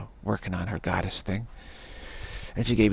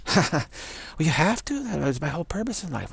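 mock whiny goddess; 6 semitones higher than usual. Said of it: "acted goddess reply".

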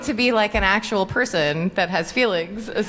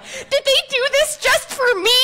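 much higher, giddy squeal of delight; delighted disbelief; 4 dB louder than usual. Retold or imitated. Actress reading a brutal script, squealing with delight. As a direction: squeal it like a gift made just for you. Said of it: "I was like".

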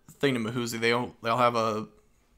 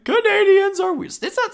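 silly high voice; 7 dB louder than usual. Pretend. Voicing Canadian jawbreaker slogan.

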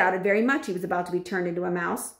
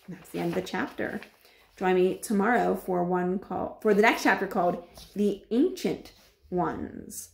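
ordinary delivery

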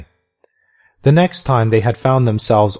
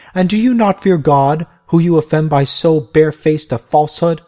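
raised, higher-pitched reproach; righteous rebuke; 6 semitones higher than usual. Acted. Mother Macrina rebuking the lying bishop, righteous. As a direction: call him out before God. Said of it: "said the Holy Mother".

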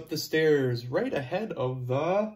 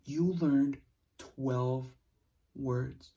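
usual speaking voice

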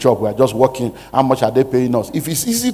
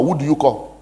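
ordinary talk

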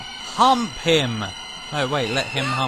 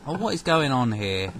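ordinary talk, nothing marked

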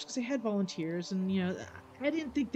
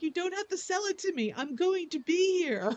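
high amused reassurance; 10 semitones higher than usual. Retold or imitated.

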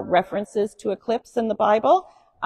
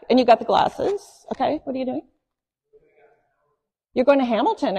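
ordinary talk, nothing marked

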